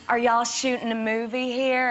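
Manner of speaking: a lighter Southern twang, a bit slow; Southern waitress. Retold or imitated. Southern waitress quizzing Emily, lightly voiced twang. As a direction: keep the small-town waitress voice going. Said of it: "And then she was like".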